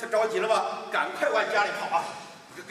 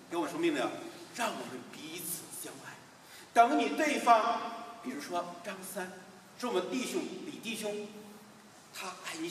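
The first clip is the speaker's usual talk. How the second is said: ordinary delivery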